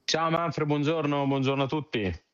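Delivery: high, ringing radio greeting. Real, not acted. Guest greets host and listeners on air, ringing voice. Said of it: "Che voce squillante".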